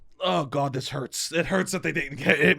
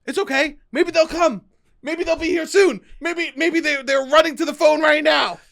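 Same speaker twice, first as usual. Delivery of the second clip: high, bright hammed-up optimism, higher pitch; cling to hope like a lonely caller; acting out bargaining while the phone rings, pitched high; mock hopeful; 11 semitones higher, 4 dB louder than usual.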